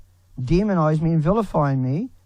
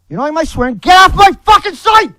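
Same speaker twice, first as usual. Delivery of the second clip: much higher, louder screaming rage; real enraged shout; furious scream; 11 semitones higher, 13 dB louder than usual. Real, not acted. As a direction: scream them off the site. Raging at critics.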